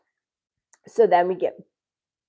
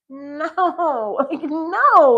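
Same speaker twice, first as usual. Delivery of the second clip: laughing no; 9 semitones higher, 6 dB louder, much slower than usual. Retold or imitated. Refusing the drink, laughing.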